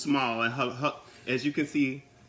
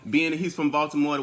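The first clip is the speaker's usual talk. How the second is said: ordinary talk, nothing marked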